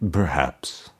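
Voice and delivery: resonant toneless skull. A narrator's reading. Buried emperor's skull answering Mordecai, low resonant toneless voice. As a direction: intone it without any tune, deep in the earth. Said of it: "answered in a resonant voice, toneless".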